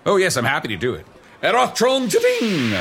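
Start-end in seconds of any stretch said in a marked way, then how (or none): 1.4-2.7 wizard incantation. Acted. Casting a spell.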